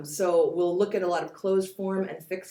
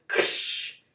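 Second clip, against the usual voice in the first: whispered "Ksh" like surf on rocks; breathy crash of a wave; ksh wave sound. Shown as a demonstration. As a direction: hiss out "Ksh" as a wave breaking. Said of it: "sounds in them that go".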